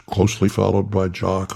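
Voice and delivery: ordinary delivery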